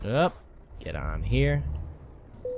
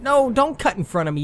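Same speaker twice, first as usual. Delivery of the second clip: angry yell; 12 semitones higher, 4 dB louder than usual. Real, not acted. Car cuts in.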